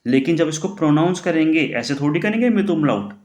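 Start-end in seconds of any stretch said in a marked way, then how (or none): none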